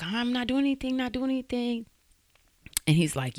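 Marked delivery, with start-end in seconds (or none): 0.0-1.9 high-pitched imitation of pleading; mimicked high, helpless protest; retold pleading. Retold victim pleading with attacker.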